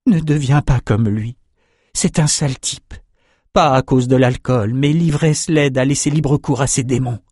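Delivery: a hint of a caring mother's murmur; mother gently warning her son; softly voiced mother. Mother tending her beaten son, lightly voiced murmur. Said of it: "lui soufflant quelques mots".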